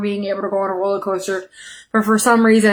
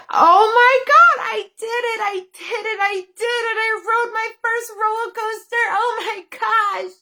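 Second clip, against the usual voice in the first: excited relief; 10 semitones higher than usual. Retold or imitated. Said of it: "I was like".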